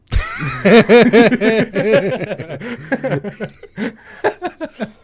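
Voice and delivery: long, high, loud fit of laughter; laughing. Real, not acted. Host cracks up over British beans breakfast, laughing hard. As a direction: completely crack up at your own joke.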